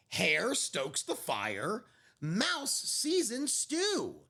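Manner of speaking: slow, hushed storybook reading; gentle picture-book narrator; story narration. A narrator's reading. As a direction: read it like a bedtime story. Picture book read aloud.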